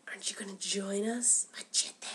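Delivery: soft breathy come-on; seductive whisper. Retold or imitated. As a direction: whisper it like a temptress. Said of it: "the ladies were like".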